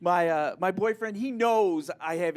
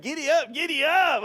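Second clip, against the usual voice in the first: higher, louder mock cowboy cheer; gleeful riding cry; 7 semitones higher, 5 dB louder than usual. Comic as kid riding coin horse, gleeful mock cheer. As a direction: whoop it up, rising on the repeat.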